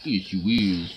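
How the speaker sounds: talking to a dog. Real, not acted. Cooing at pet.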